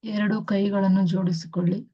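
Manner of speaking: very slow, soothing meditation guidance; guided meditation. Acted. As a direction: ease them into stillness, word by word. Guides meditation with long pauses.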